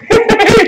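real high, loud laugh. Real, not acted. Storyteller laughing after retelling his insult, real laugh. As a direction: burst out laughing at your own comeback.

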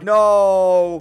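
sudden loud cry of dismay; shouted no. Real, not acted. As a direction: yell it as you crash. Falls off the track.

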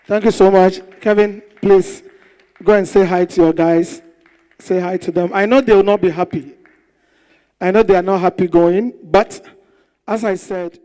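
booming announcer; show host. Acted. Host sending the evicted contestant to his fans, booming. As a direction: send him off like an MC.

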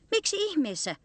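high-pitched puzzled asking; baffled question. A narrator's reading. Listening animal asks the bear why humans do it, high baffled question.

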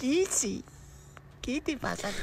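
high, soft cooing to the dog; tender high coo to a hurt dog. Real, not acted.

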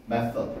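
method의 th를 ㅆ으로, '메쏘드'; method를 '메쏘드'라고 느리게. Shown as a demonstration. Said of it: "method도 아니고". (method, th 없이 '쏘드'로)

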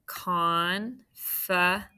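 confident stretched out very slowly, syllable by syllable; teacher slowly sounding out confident. Shown as a demonstration. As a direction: drag out confident to split its syllables. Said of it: "let's mark that one up".

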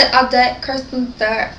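low, failed attempt at Rob Pattinson's accent; half-hearted Rob Pattinson accent; botched Rob Pattinson accent. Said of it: "I didn't do the accent right".

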